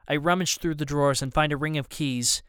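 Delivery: plain reading voice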